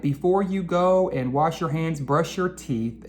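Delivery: usual speaking voice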